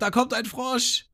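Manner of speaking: high, slow, excited Ruffy yell; mock shout. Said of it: "Ruffy schreit". (hoher, langsamer, aufgeregter Ruf)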